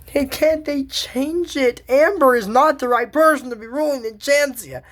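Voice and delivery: ordinary talk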